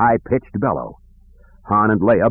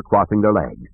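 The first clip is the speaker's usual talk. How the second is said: ordinary delivery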